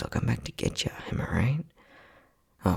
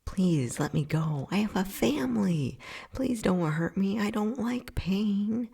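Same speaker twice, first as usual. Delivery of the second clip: mocking whiny victim; 10 semitones higher than usual. Scientist mocks what every captive subject always says, high whiny mimicry. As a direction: sneer at their pleas by parroting them high. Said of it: "It's always".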